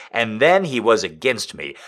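ordinary delivery

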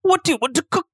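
chef character voice. Acted.

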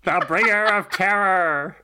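high, grandiose tiny demon voice; Gaknar. Acted. Tiny fear demon announces himself to the gang, hammed-up menace. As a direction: proclaim each title slowly, drawing out the last words. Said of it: "Kara, do the honors".

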